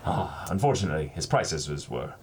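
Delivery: reading a pirate roleplay letter with flair; storybook narration. Acted. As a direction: tell it like a sea yarn.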